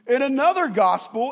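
ordinary delivery

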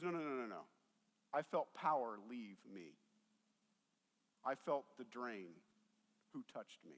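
low, soft, intent insistence; quiet insistence. Retold or imitated. Jesus insists quietly he felt power.

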